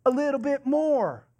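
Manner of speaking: mock millionaire shrug; quoting a rich man. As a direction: toss it off like a man who never has enough. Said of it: "you know what his answer was?".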